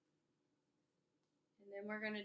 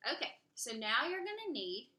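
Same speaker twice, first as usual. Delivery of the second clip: plain reading voice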